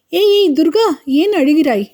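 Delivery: sudden high-pitched alarm; startled concern. Acted. Kailash startled to find Durga crying, high alarmed call. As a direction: jump up in pitch, caught off guard.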